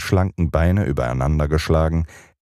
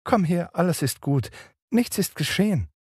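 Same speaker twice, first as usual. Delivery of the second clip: comforting; 14 semitones higher than usual. A narrator's reading. Wendy comforts crying brother.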